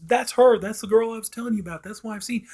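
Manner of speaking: high-pitched, insistent retold exclamation. Woman pointing out the girl she was telling about, insistent. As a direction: quote her urgently, pitched well up. Retelling her insistent sighting.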